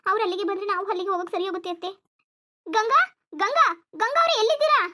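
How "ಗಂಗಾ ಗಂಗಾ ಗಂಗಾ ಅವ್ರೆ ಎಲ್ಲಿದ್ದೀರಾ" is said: high, loud call across the house; calling out loudly. Acted. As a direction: shout for Ganga next door. Shouting for the neighbour. (ನೆರೆಮನೆಯ ಗಂಗಾಳನ್ನು ಜೋರಾಗಿ ಕೂಗುವುದು)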